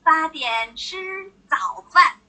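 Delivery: 八点吃早饭 said very slowly, each syllable clear; slow model sentence. Shown as a demonstration. Teacher models an answer for students, slow and clear. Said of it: "八点做什么".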